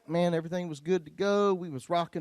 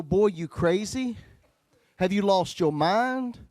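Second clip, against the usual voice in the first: slow, louder sassy disbelief; mock-incredulous woman; sassy reply; 4 semitones higher, 5 dB louder, slower than usual. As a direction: drag it out like a sassy girlfriend.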